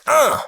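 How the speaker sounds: high shouted funk grunt; sudden high shouted uh. Retold or imitated. [name] payback reference.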